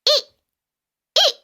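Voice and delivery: short high monkey squeaks; monkey eek. Shown as a demonstration. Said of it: "A monkey goes like this".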